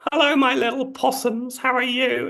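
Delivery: put-on very high falsetto Australian accent; Dame Edna. Comedian's stage dame greets the audience, high Australian accent. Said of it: "in that amazing Australian accent".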